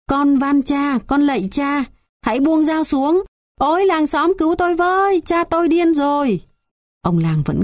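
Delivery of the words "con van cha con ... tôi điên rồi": high, frantic pleading cry; terrified begging. Acted. Bound son begs his father and screams to the village, high and frantic. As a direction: beg for your life, then call for help.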